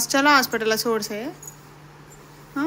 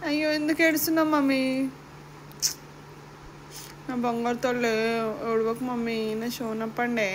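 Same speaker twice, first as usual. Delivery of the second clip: soothing; 7 dB quieter than usual. Real, not acted. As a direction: hush her gently, getting softer. Mother comforts crying daughter. (ఏడుస్తున్న పాపను మృదువుగా ఓదార్చడం)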